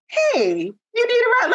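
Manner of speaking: high, sleazy pickup line; creepy stranger offer. Retold or imitated. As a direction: act like a creep offering a ride.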